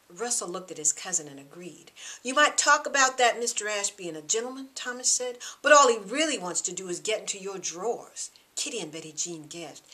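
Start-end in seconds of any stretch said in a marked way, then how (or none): 2.2-8.3 loud, contemptuous jab; mock the idea with scorn; sneering. Thomas deriding Mister Ash to the girls, loud and sneering.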